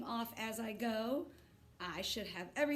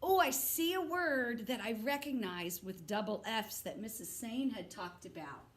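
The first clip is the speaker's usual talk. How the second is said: ordinary delivery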